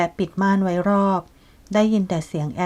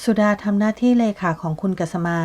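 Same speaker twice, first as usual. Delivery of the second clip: ordinary delivery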